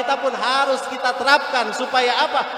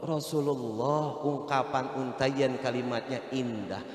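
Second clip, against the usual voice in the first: ordinary talk, nothing marked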